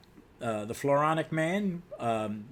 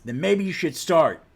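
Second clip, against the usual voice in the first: loud, raised challenge; heated retort; 4 semitones higher, 7 dB louder than usual. Acted. Young hero squaring up to the superhero, loud heated retort. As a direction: throw it back forcefully. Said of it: "And then Jack responds".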